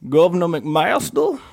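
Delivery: a light Southern drawl on the name; South Carolina governor impression. Guest imitating his state's governor, lightly Southern. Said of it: "Or I could speak like the".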